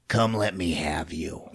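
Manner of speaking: hushed mock seduction; come-hither. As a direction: lure him in, low and quiet. Acting out her walking towards him.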